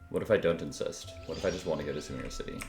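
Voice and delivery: ordinary talk, nothing marked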